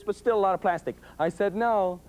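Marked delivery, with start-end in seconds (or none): 1.2-2.0 slightly firmer no. Retold or imitated. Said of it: "a little more serious".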